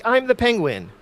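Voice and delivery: high nasal Penguin squawk; quick Batman Penguin impression. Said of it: "a Batman Penguin, uh, impression when he's like".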